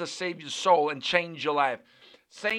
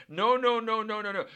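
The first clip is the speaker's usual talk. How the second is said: higher, insistent string of noes; emphatic refusal; 4 semitones higher than usual. Real, not acted. Preacher correcting those focusing on the negative, emphatically. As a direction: cut the complaint off with rapid noes.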